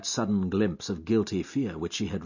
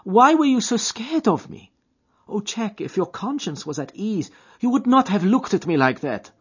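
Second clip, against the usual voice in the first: put-on high, louder voice of an anxious girl; young woman, distressed; 12 semitones higher, 7 dB louder than usual. A narrator's reading. Etty questioning Jack's guilty fear, high distressed voice. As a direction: press him, hurt and suspicious.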